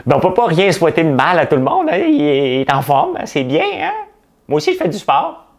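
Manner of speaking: high-pitched sugary parody; mock mayor. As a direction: simper a fake compliment. Mocking mayor's answer about Coderre.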